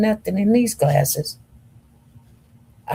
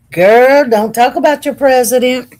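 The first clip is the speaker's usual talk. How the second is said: louder and higher, teasing a viewer; playful scolding; 6 semitones higher, 6 dB louder than usual. Real, not acted. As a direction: scold the chat half-jokingly. Scolding a viewer's Trump comment.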